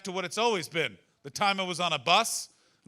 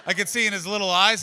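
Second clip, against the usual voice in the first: usual speaking voice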